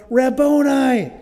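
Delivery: high, loud, drawn-out cry of recognition; stretched, ringing exclamation from scripture; exclaimed recognition. Acted. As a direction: call out the title slowly and loudly. Mary recognizes Jesus, calling out.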